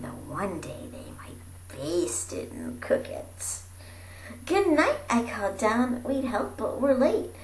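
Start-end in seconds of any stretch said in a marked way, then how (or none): none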